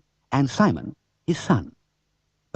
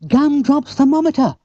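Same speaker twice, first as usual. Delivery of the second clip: much higher, louder joyful shout; Bill finding the lost thermometer; delighted cry; 8 dB louder than usual. Acted. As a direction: burst out in glad surprise. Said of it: "cried Bill".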